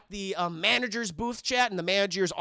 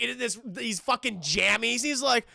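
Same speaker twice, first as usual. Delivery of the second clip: ordinary talk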